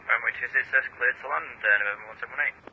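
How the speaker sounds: tinny radio readback; distant crackly radio call; pilot over the radio. Real, not acted.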